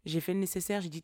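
normal talking voice

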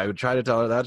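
usual speaking voice

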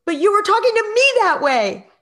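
put-on high, loud child's comeback; defiant retort. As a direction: fire back at mom.

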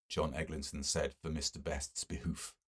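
ordinary delivery, nothing marked

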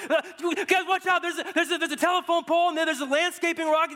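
high frantic yell from the back seat; retold panicked yell at the driver; panicked screaming. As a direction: shriek the warning like a terrified passenger. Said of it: "Shane in the back seat is just screaming".

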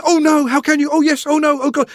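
high, loud flurry of inner panic; panicked dithering. Acted. Father's head racing at the sight, panicked dithering. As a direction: argue with yourself at full pitch.

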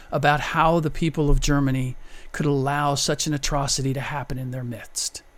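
ordinary delivery

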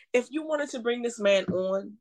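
ordinary talk, nothing marked

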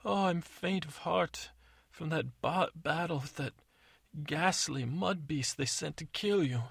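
high, slow swooning voice; hammed-up swoon; fake faint. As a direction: overplay the fainting lady. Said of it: "acts like she's".

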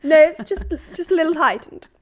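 usual speaking voice

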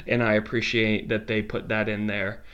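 ordinary delivery

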